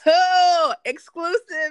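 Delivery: loud, high mock newsflash; mock exclusive. Co-host announcing pregnancy gossip to listeners, mock exclusive. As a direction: trumpet it like a scoop.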